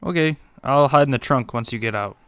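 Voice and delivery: ordinary delivery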